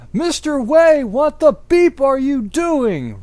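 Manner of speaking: high, loud furious shriek; hammed-up shrieking teacher; angry shout. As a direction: screech it at the student. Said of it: "Rumbridge shouted angrily".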